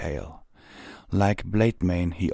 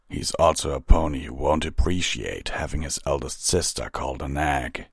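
low, restrained reproof; measured captain; 4 semitones lower than usual. Acted. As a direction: caution a subordinate in a low voice. Vanguard's dry caution.

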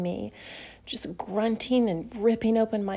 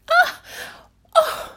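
sharp high startled cries; gasp, caught off guard; shocked gasp; 8 dB louder than usual. Acted. Gasps as he grabs her.

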